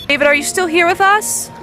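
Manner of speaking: normal talking voice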